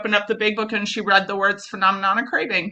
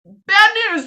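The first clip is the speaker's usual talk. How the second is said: playful warning; 9 semitones higher, 4 dB louder than usual. Retold or imitated. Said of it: "and she goes".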